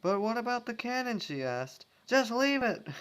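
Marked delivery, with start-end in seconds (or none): 0.0-1.2 anxious call. Acted. Sweetie calling after. 2.1-2.9 shouted dismissal. Acted. Apple Bloom calling back.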